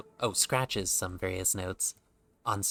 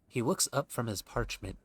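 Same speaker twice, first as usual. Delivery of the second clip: normal talking voice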